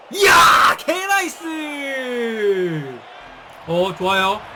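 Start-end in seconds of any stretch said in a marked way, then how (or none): 0.1-3.0 loud high burst of joy; excited yell. Real, not acted. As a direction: shout it out at the goal. Goal chance, streamer yells. (신나서 크게 외치는 환호)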